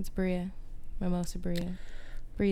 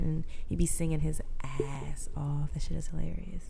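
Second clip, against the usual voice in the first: hushed whisper, slowed; 3 semitones lower, 10 dB quieter than usual. Real, not acted. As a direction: lean in and whisper it. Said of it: "whispering aside".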